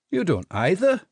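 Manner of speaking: cheerful little Scots private; Scottish jelly baby. A narrator's reading. Jelly baby explains his lineage to Jinx, cheerful Scottish. Lennox retort.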